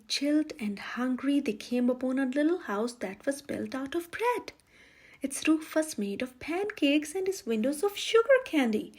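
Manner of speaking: plain reading voice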